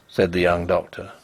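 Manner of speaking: ordinary delivery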